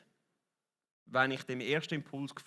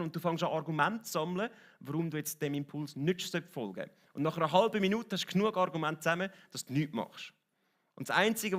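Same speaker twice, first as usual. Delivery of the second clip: ordinary talk, nothing marked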